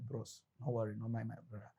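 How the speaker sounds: good cop calming a suspect, soft and coaxing; soothing cop. Retold or imitated. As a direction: coax the suspect gently, softly.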